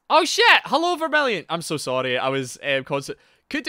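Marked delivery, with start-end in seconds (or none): 0.1-1.4 high, loud startled cry; loud, flustered high-pitched welcome; startled greeting. Real, not acted. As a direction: yelp a hello as if caught off guard. Startled, calls out to viewer.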